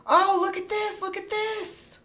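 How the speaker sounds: high, gushing look at this; excited high coo; delighted. Real, not acted. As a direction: coo over what you see. Delighted at new screen.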